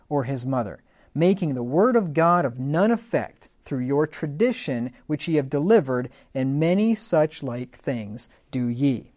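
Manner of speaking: emphatic, declamatory Bible reading; scripture reading. Acted. Preacher reads Jesus rebuking hypocrites, emphatic scripture reading. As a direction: read the verses out with weight on key words.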